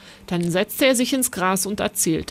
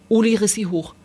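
plain reading voice

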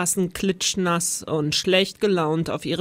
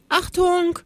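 high-pitched warning call; 11 semitones higher than usual. A narrator's reading. Teacher warns the class before growing, high call. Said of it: "Warnend hob er einen giftgrünen Finger".

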